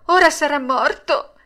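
high, loud, distressed interruption; anguished high puppet cry; greatly pained. A narrator's reading. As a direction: break in, dreading the worst. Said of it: "interruppe Pinocchio con gran dolore".

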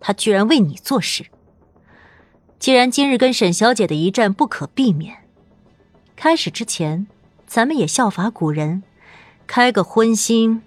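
high, scornful female villain voice; cold sneering laugh. Acted. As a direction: taunt her with icy scorn. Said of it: "司藤看着沈银灯冷笑道".

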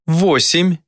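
counting down a life; demon counting. A narrator's reading.

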